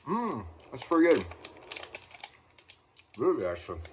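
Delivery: chewing while talking; speaking around candy; mouth full. Real, not acted. Seen 1: Candy brought to mouth at -1; closed, working mouth while speaking after.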